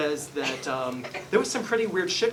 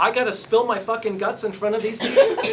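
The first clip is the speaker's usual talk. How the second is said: laughing complaint; 7 semitones higher, 8 dB louder than usual. Retold or imitated. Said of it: "He says".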